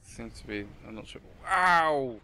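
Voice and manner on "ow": loud, high "ow"; pained yelp. Real, not acted. Player cries out as the ship takes damage, pained yelp. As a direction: yelp as if hit. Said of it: "That really hurt".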